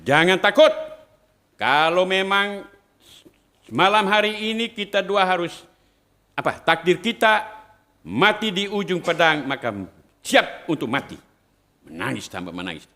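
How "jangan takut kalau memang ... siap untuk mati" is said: bracing speech. Retold or imitated. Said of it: "Dia bilang".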